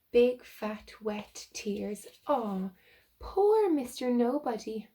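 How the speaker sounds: ordinary delivery, nothing marked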